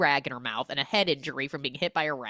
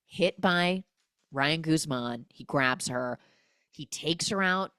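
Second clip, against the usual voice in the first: ordinary delivery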